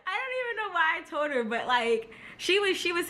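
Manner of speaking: high, quick, laughing words. Real, not acted. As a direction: talk fast through a giggle. Still laughing at herself.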